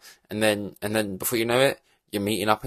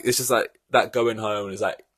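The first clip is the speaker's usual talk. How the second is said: ordinary talk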